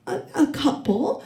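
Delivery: slow, halting admission with rising end; nervous hesitation. Retold or imitated. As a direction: stammer it out like a question. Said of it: "You look a little nervous".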